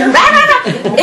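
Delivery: high, loud nonsense yelling through laughter; mock yelling. Daughter mimicking mom's rant at wrestling kids, mock yelling. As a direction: imitate a scolding parent ranting on wordlessly. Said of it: "my mom would be yelling".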